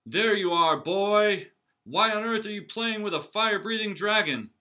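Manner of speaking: loud, high scolding call; shout. Acted. Knight's father shouting at his son, loud and high. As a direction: yell across the yard at your boy. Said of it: "they heard a shout".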